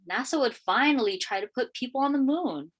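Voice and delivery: usual speaking voice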